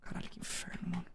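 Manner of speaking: breathy whispered swearing; whispered curse. Real, not acted. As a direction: hiss it under the breath. Xingando baixinho no jogo. (xingamento sussurrado)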